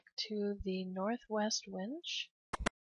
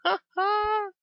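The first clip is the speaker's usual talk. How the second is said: high, loud whooping celebration; excited cheer; 10 semitones higher, 9 dB louder, much slower than usual. Real, not acted. Gamer runs off after setting the bomb, whooping loudly. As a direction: whoop and crow in triumph.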